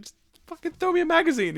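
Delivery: high, strained mock demand; exasperated demand. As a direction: imitate someone fed up in a gunfight. Acted exasperated gunfight exchange.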